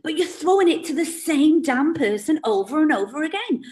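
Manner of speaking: normal talking voice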